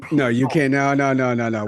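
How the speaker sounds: ordinary delivery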